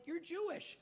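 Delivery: squeaky high disbelief; can't believe it; incredulous. Retold or imitated. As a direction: pitch jumps way up in disbelief. Incredulous reply.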